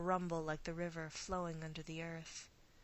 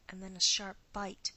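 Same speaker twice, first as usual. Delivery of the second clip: usual speaking voice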